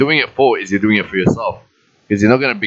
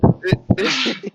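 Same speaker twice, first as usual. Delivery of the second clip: sudden high-pitched laugh. Real, not acted. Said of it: "I can't stop laughing".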